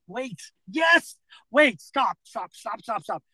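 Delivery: high, repeated pleas to stop; flustered interruption. Real, not acted. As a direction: cut them off urgently, pitch up. Tries to halt the accusation.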